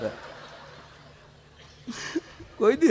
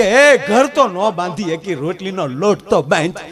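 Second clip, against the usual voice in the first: louder, slow mock-angry jab at the wife; a retold snap, half in jest; husband scolding wife about dough, mock angry; angry retort; 7 dB louder, slower than usual. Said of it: "હું ખીજાઈને બોલ્યો".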